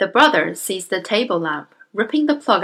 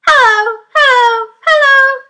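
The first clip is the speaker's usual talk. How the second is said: parrot calling; 10 dB louder than usual. Acted.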